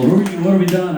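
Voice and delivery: lightly voiced bystanders; curious crowd. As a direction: pester the new guy. Crowd questioning him.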